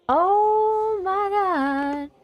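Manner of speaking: high, slow drawn-out gasp; scared horror game streamer. Real, not acted. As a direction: stretch it out in high alarm. Startled by zombies.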